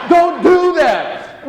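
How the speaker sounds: very slow, high, loud "Don't do that"; slow, stretched warning to his wife; imagined plea. Retold or imitated. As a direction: drag it out in mock desperation. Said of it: "I'm just like".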